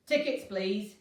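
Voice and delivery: loud curt bark; barked order. A narrator's reading. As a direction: bark it at her like a rude inspector. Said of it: "he barked".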